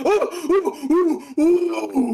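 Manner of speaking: high gasping panic; panicked gasps. Real, not acted. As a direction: gasp in fright. Falls in scary game.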